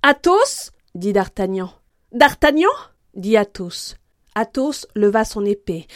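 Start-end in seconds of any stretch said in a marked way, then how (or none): 0.0-0.7 loud, high, rising call; cry of recognition. A narrator's reading. D'Artagnan recognising his friend mid-fight, loud surprised cry. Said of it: "jetèrent chacun un cri". 2.1-2.7 high, loud, rising shout; cry of recognition. A narrator's reading. Athos recognising his opponent, loud surprised cry. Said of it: "jetèrent chacun un cri".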